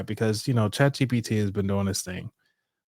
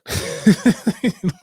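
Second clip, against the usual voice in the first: real laugh; 10 semitones higher, 5 dB louder than usual. Real, not acted. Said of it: "I sound like a pirate".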